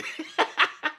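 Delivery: high chuckle; laugh. Real, not acted. Amused at sweet breakfast.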